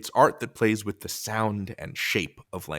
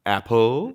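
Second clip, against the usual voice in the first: APPLE with the second syllable punched and rising; stress on second syllable; 4 dB louder than usual. Shown as a demonstration. Teacher shows a wrong stress, second syllable of APPLE stressed. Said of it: "apple is not standard".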